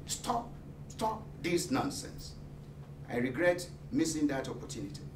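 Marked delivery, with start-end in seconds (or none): 0.1-2.3 hushed protest. Retold or imitated. Imagined protest he never made.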